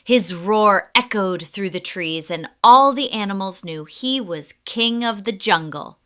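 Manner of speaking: ordinary delivery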